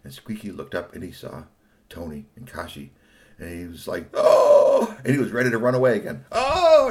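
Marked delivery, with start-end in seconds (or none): 4.1-5.0 high, loud breathy gasp of fright; startled gasp. Acted. Squeaky startled. 6.3-6.9 high, loud oh of fright; startled cry. Acted. Squeaky startled again.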